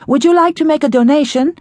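high droning repetition. A narrator's reading. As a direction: drone it mechanically. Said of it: "the woman droned".

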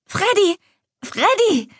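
put-on high, delighted child's cry; excited child calling. Little sister spotting her returning big sister, excited calling. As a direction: call the name in a high, thrilled child voice.

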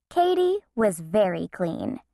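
plain reading voice

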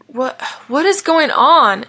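high, loud, halting question; panicked bewilderment, drawn out; alarmed, short of breath. A narrator's reading. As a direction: stumble on the first word, then stretch it out in alarm. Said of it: "he suddenly found it hard to breathe".

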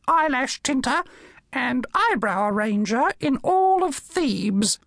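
high squawky parrot voice, slow and grand; high-pitched bird reading an epitaph with ceremony; parrot reading aloud. Acted. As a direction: proclaim the tomb text like a pompous parrot. Macaw reads the inscription aloud.

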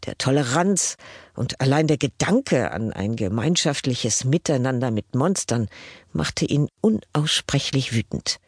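ordinary delivery

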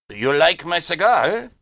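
sly German teasing; knowing German; German accent. A narrator's reading. German voiced.

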